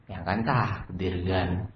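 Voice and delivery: low, soft, slow placating reply; subdued servant's mumbled excuse; meek excuse. Acted. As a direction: offer fate as a timid defence. Servant excuses himself to boss.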